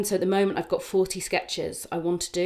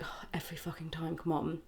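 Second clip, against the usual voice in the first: muttered complaint; 9 dB quieter than usual. Retold or imitated. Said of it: "So I can get being like".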